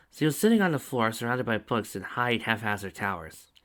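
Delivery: ordinary delivery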